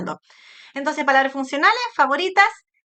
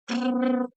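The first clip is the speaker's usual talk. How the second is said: lips vibrating brrr; lip trill; 3 dB quieter than usual. Shown as a demonstration. Therapist demonstrating lip vibration to parents, lip trill. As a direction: buzz the lips. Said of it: "la vibración de labios".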